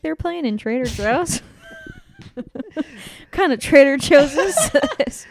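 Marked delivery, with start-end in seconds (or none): 1.5-5.3 slow, much louder disbelieving laugh-talk. Real, not acted. Cohost laughing in disbelief at the story, slow and loud.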